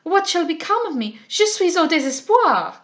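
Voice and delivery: put-on loud, high French-accented despair; exclaimed. A narrator's reading. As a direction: wail theatrically as a stranded Frenchwoman. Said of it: "exclaimed the stranger".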